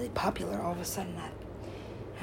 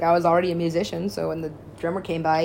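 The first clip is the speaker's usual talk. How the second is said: normal talking voice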